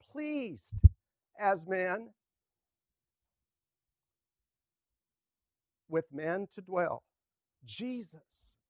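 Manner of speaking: slow, emphatic hymn verse read aloud; hymn recitation. Acted. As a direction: declaim each phrase with strong openings and long pauses.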